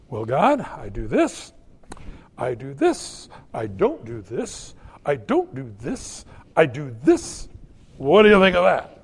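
mock smug bargaining with God; smug checklist prayer.

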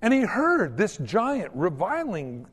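ordinary talk

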